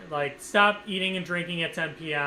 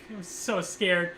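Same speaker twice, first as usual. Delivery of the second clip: normal talking voice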